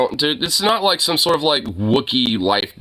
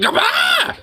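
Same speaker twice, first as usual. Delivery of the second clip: imitated high-pitched startled yelp. Retelling how the old lady jumped at the dog, a high yelp. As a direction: squeak it out like someone jumping at a fright. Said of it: "She went".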